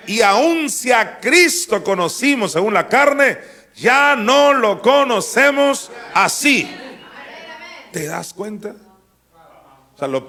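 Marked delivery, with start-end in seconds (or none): 0.1-6.7 loud, slow, high pulpit reading; slow declaiming sermon voice; preaching at full force. Acted. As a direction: thunder the verse out to the congregation. Preacher reads scripture at full force.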